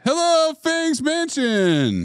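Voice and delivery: high, stretched arena rock greeting; rock concert shout. Retold or imitated. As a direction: belt it like a hair metal frontman. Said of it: "like a [name] concert".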